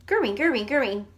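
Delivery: three fast gurrings in one breath; fast repetition. Shown as a demonstration. Teacher racing the stopwatch, fast and loud. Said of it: "as fast as we can".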